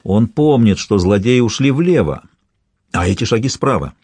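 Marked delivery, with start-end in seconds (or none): none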